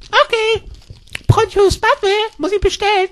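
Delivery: very high, squeaky little voice; timid pharmacy girl. Acted. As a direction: squeak it hesitantly, reading out a prescription. Pharmacy girl reads the prescription. (piepsige, zaghafte Apothekerin)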